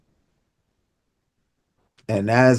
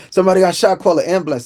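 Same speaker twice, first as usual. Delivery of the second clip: higher, louder mock emergency call; urgent call; 7 semitones higher, 3 dB louder, faster than usual. Bystander phones in a shooting, urgent call. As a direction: imitate a caller urgently summoning help. Imagined callers phoning for help.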